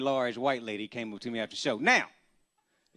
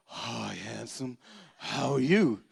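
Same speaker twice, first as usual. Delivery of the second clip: flirty large lady; 5 semitones lower, much slower than usual. Retold or imitated. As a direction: purr it out slowly to seduce. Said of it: "She was like".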